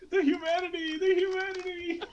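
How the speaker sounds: high, loud frantic news reporter. Retold or imitated. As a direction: wail it like a panicked newsman. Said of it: "he's like".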